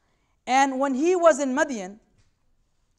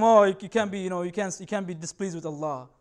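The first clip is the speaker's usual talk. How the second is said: mock pious critic; 6 semitones lower than usual. Mocking a scolding critic.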